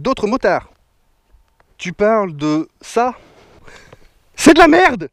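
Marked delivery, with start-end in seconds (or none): 4.4-5.1 higher and much louder outburst; loud blunt verdict. Real, not acted. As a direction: blurt it out in disgust. Verdict on the intercom.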